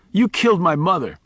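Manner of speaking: high accusing cry, stress on killed; petulant accusation. A narrator's reading. Boy Miles blaming Charlie for his mother, petulant. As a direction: blame him like a hurt child. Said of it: "even more petulant".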